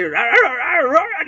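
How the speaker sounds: loud, high-pitched frantic yapping; imitated dog barking. Storyteller mimicking three caged dogs, loud mock barking. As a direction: bark back like riled little dogs.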